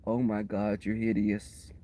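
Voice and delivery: low, soft appalled mutter; appalled at herself. Retold or imitated. Looking at herself in the mirror, softly appalled. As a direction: mutter it in horror at your own reflection. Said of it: "I looked at myself and I was like".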